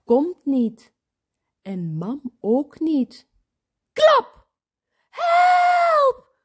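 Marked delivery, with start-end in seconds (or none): 3.9-4.5 high, loud "klap"; sudden bang noise. A narrator's reading. Imitated bang from the garden. 5.1-6.4 high, loud drawn-out shout; frightened child's piercing call; crying for help. A narrator's reading. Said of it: "Roept Job".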